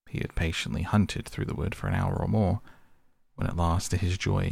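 plain reading voice

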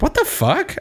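very high incredulous squeak; acted-out bewilderment; baffled shock. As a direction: react in high-pitched disbelief. Repeats viewer's baffled reaction.

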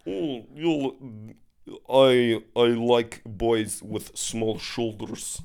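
put-on slow Russian accent. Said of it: "please do a Russian accent".

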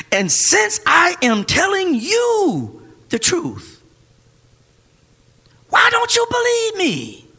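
rising to a high, shouted challenge; preaching shout. Acted. Preacher voicing Jesus to the crowd, shouting. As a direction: build slow then shout the question.

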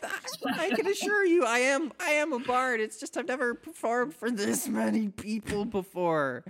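put-on high, stammering, jittery young bard; nervous high bard voice. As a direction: squeak it out, caught off guard. Bard protests, flustered.

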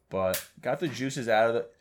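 ordinary delivery

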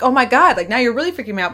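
retold alarm. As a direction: exclaim as if freaked out, retelling it. Retells her alarmed demand to husband.

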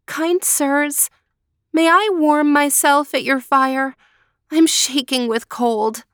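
put-on girl asking the months to warm herself, politely; polite request. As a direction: ask kindly, shivering. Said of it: "said, politely".

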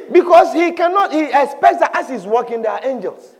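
ordinary delivery

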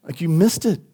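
acted sigh into a mild letdown; sighing disappointment. God to the disobedient, sighing disappointment. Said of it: "he sighs, like".

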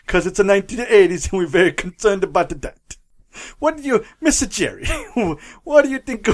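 hammed-up fake Italian accent as the Pope; mock Italian Pope asking for advice; lightly put-on Italian accent, laughing through it; bad Italian accent. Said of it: "stereotypical bad Italian accent".

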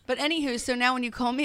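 usual speaking voice

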